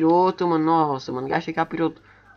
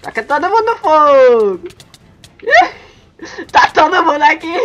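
gleeful yelling; excited shout; 9 semitones higher, 6 dB louder than usual. Real, not acted. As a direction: shout it with delight. Shouting in chaotic fight.

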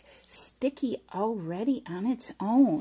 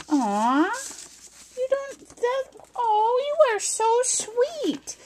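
touched; 12 semitones higher than usual. Real, not acted. Touched by birthday card.

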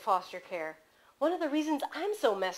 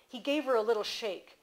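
plain reading voice

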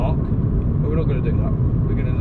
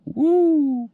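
high playful woo; 11 semitones higher, 3 dB louder than usual. Pretend. Mimicking the choppy waves.

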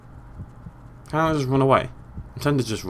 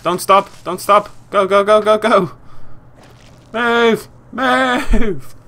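high, loud, building urgency; frantic raised-voice commands; urgent urging; 8 semitones higher, 5 dB louder, much slower than usual. Real, not acted. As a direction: drive them on with repeated shouts. Urging on while fleeing.

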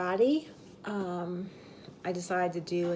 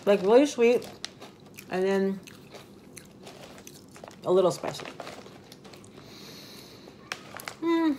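chewing between words; talking while chewing. Real, not acted. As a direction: mouth partly full of chip. Seen 2: Chip bitten at -4; jaw and pursed-lip chewing continue during the words.

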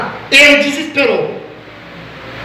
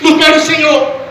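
fervent preaching; 5 semitones higher than usual. Real, not acted. Preacher telling the congregation how he prayed, fervent preaching.

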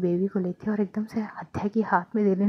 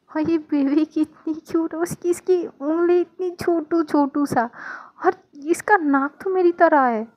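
higher, delighted cooing; gushing; 6 semitones higher than usual. Acted. New mother coos over her baby, pitched up. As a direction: coo over the tiny baby.

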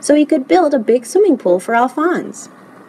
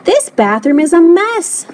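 higher-pitched dismayed moan; moaning complaint; 8 semitones higher, 3 dB louder than usual. A narrator's reading. Lewis's mother faces the messy bathroom, moaning. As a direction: moan in mild exasperation. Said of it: "moaned Lewis's mother".

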